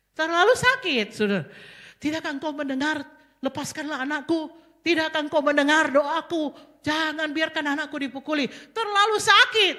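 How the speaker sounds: high, loud, pleading cry to God; anguished mother's plea. Acted. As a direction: beg God as a grieving mother. Mary voiced crying out. (ratapan ibu yang memohon dengan keras)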